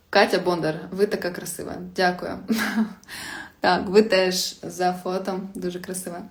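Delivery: normal talking voice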